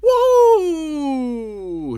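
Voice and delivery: hoarse cracking whoop; cracked voice. Real, not acted. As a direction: whoop through a worn-out voice. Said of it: "J'ai la voix cassée".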